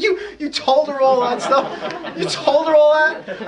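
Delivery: high, loud laughing incredulity; can't believe what he's hearing; retelling his stunned laughing reply to a friend; laughing disbelief. Retold or imitated. Speaker incredulous at friend's story.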